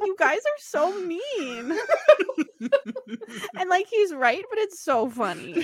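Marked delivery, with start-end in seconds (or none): 0.0-1.8 whiny. Retold or imitated. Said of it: "Josh is like".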